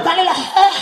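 lightly voiced entranced teacher; hungry teacher. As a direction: sound entranced by the smell.

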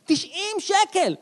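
slow, high, stretched cry of outrage over the price; mock-indignant price shock; outraged exclamation. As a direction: drag out תשעים שקל in high disbelief. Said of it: "repeated with emphasis".

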